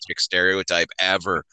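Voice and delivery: low, soft eye-rolling groan; exasperated. Retold or imitated. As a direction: drone it with a sigh. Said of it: "I'm like".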